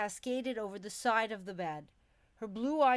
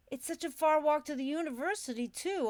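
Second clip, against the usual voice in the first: bemoaning; 7 semitones higher than usual. A narrator's reading. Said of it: "I bemoaned".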